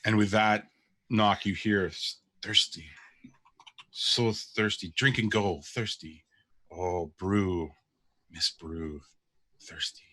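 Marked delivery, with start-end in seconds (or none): 1.8-10.0 slow, fading creature mumble; podling mumbling. Acted. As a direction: drag the words out like a hungry little creature. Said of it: "the podling mumbling under his breath".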